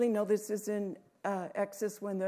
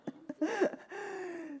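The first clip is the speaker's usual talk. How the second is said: light laugh; 9 semitones higher, 4 dB quieter than usual. Real, not acted. Laughs at technology joke.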